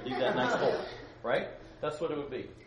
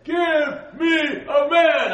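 booming Goliath; 14 semitones higher, 15 dB louder than usual. Acted. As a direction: bellow the challenge like a giant warrior. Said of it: "it's better if I do it this way".

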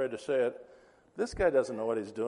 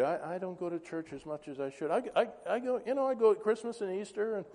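high-pitched, stammering mock excuse; sheepish excuse; 6 semitones higher than usual. Neighbour excusing poor church attendance, high and sheepish. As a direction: act a flustered, defensive churchgoer.

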